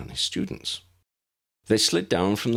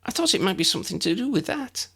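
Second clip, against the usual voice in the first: tentative; 13 semitones higher, faster than usual. A narrator's reading. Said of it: "she ventured".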